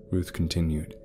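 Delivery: usual speaking voice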